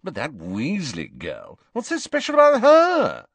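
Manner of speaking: builds to a loud, high cry; exasperated, soaring into a shrill shout; scornful outburst. A narrator's reading. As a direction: start measured, then explode upward. Malfoy's outburst about Weasley girl.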